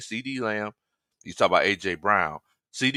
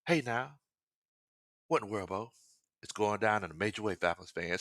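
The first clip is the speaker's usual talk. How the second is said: a hint of imitating his homeboy's laid-back line; Jack Spade; 9 dB quieter than usual. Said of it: "Let me say it like Jack Spade".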